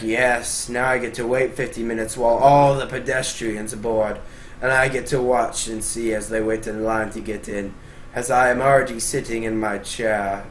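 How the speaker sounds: lightly voiced self-satisfied first-class snob; smug priority passenger. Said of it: "you're gonna be like".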